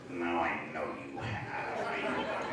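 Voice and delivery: mock-menacing drop into near whisper; insistent caller.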